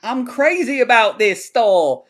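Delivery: high, slow, over-the-top villain voice; manic movie villain. Retold or imitated. Podcast host quoting a crazed villain, high and slow. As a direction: stretch it out like a grinning madman. Mimicking actor's manic line.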